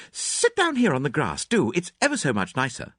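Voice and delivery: put-on friendly, inviting girl; Cynthia. A narrator's reading. Cynthia voiced.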